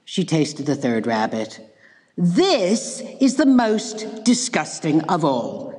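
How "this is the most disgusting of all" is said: slow, stretched disgust in a put-on girl voice; slow exclaimed disgust. Goldilocks after the third rabbit, slow exclaimed disgust. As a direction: drag the words out in peak revulsion. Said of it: "she exclaimed".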